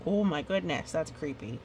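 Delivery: plain reading voice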